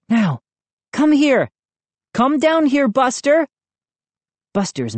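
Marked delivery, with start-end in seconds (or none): 0.1-3.5 high, urgent coaxing; crying out. A narrator's reading. Brother calling dog down from window, crying out. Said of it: "I cried".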